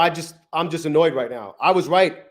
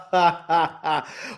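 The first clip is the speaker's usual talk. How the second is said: starts to crack up; laughing. Real, not acted. Laughing at losing more accounts.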